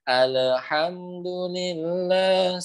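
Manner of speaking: "Alhamdulillah" very slowly, lam bounced off the palate; slow recitation demo. Shown as a demonstration. Teacher showing the common bouncing lam in Al-Fatihah, slow demo. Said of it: "seperti itu kadang".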